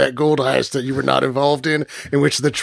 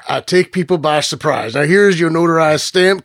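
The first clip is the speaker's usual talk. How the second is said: normal talking voice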